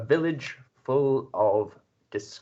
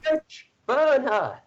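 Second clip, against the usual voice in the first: high, loud villager yell; mob heckle; 14 semitones higher, 3 dB louder, slower than usual. Acted. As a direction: shout him down like a mob.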